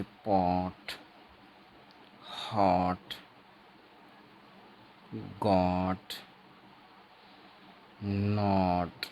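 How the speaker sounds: "पॉट, हॉट, गॉट, नॉट" with a rounded ऑ, "नॉट" drawn out slowly; teacher demonstrating ऑ in "पॉट, हॉट, गॉट, नॉट"; short ऑ demo. Shown as a demonstration. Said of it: "आप इसको ऐसे भी लिख सकते हैं".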